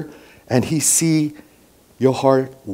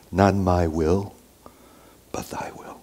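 hushed prayer; 4 semitones lower, 8 dB quieter than usual. Acted. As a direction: pray it low, letting the end fade to a whisper. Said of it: "And then he prayed".

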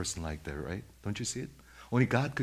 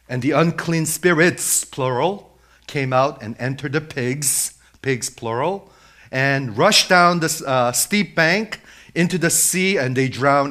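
loud, raised pulpit storytelling; forceful, emphatic preacher; full-force preaching; 4 semitones higher, 10 dB louder than usual. Acted. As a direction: drive the story forward at full voice.

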